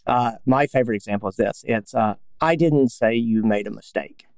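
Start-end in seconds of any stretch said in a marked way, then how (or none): 2.4-4.2 I stressed, falling tune; I stressed in "I didn't say you made a mistake". Shown as a demonstration. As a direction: lean on the word I. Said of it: "And those are all different".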